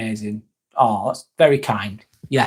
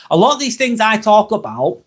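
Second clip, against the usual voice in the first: slightly too loud, pitched up high; raised, higher voice, a little overloud; a bit loud; 7 semitones higher than usual. Real, not acted. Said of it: "sorry, going a bit loud there".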